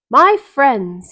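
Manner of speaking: loud, ringing salesman greeting; clear, keen announcing. Acted. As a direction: announce it to the crowd, bright and sure. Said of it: "he announced in a voice clear and keen".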